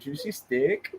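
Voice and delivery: laughing, mock northern steak; northern accent. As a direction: tease her northern accent. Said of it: "talking like a northern person".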